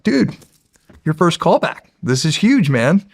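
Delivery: high, bright burst of excitement; excited. Retold or imitated. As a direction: cheer him on, thrilled. Dad thrilled by callback news.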